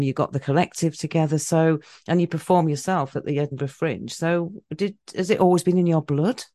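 ordinary talk

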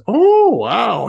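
high retold "wow" of wonder; mock amazement. Re-enacting amazed reaction.

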